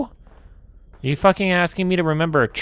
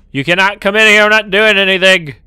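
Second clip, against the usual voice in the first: louder frightened pleading; panicked yell; 5 semitones higher, 10 dB louder than usual. Real, not acted. Gamer yelling at a creature in the room. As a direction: shout it out in panic.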